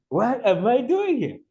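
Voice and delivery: high-pitched bewildered self-quote. Retold or imitated. Newcomer recalling arrival in a foreign land, bewildered. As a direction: replay your own shock, pitch leaping up.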